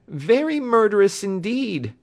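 "Very murderous indeed" high and loud; emphatic confirmation. A narrator's reading. Patient confirms the attack to the doctor, "Very murderous indeed" emphatic.

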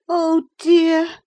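high, loud lament; high-pitched wail of self-pity; Catherine, crying out. A narrator's reading. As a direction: cry out in a high young lady's voice. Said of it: "she cried at last".

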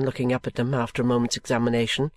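ordinary delivery

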